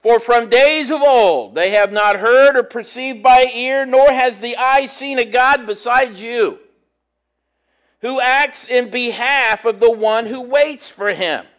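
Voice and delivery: reading scripture to the congregation. Acted. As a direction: proclaim the verse from the pulpit. Reads scripture aloud.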